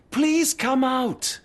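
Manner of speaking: high, slow call through the door; calling out gently to a man hiding behind a door. Real, not acted. Coaxing someone out through door.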